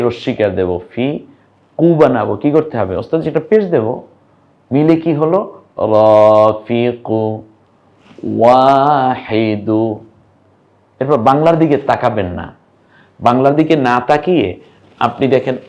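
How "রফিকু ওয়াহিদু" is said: রফিকু and ওয়াহিদু each very slow, endings held long; drawn-out reading. Shown as a demonstration. Teacher to learners, reading the finished words very slowly. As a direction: chant the words out syllable by syllable. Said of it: "teacher reading the built words".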